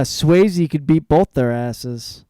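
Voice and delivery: loud, slow, emphatic quote; reading out a comment. Retold or imitated. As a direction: announce it loudly and stretch it out. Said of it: "And someone commented".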